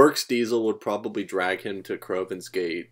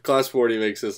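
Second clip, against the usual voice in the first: ordinary talk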